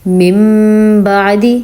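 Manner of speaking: من بعدی with lips closed, م hummed through the nose; lip ghunna. Shown as a demonstration. Teacher demonstrating lip-closed ghunna to students. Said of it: "دونوں ہونٹوں کو جوڑ کے".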